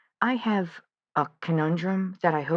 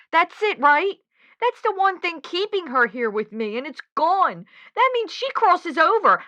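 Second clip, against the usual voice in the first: pleading much higher and louder, near tears; desperate pleading; 8 semitones higher, 4 dB louder than usual. Acted. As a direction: plead as if she can't bear to lose her. Desperate about losing ghost lover.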